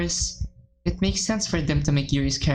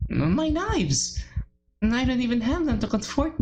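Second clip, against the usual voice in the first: wailing; 7 semitones higher than usual. Acted. Yuri breaking down over knives.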